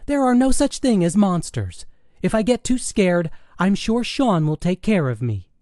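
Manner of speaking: high daughter's voice, brushing off Mom; high-pitched, self-assured daughter; dismissive teenage daughter. Acted. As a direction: wave away your mother's worry in a girlish pitch. Daughter dismisses mother's warning.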